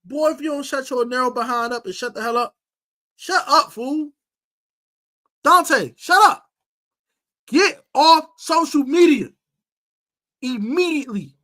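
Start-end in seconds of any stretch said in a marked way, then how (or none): 3.2-6.5 loud, high snapping shut up; angry shout. Real, not acted. Host yelling at Dante to shut up, angry. 7.5-11.3 stern loud order. Real, not acted. Host ordering Dante off social media, stern and loud. Commanding the player.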